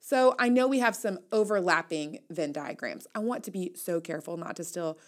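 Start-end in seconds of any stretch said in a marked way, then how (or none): none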